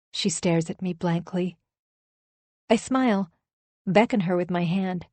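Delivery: ordinary delivery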